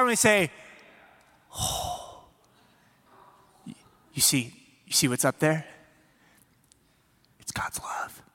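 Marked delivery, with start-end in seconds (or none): 1.6-8.2 breath-only stage whisper with long pauses; hushed awe. Acted. Leader showing children what is up there, hushed wonder.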